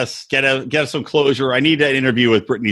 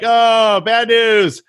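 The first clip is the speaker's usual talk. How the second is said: mock dramatic news; 6 semitones higher, 5 dB louder, much slower than usual. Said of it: "she's like".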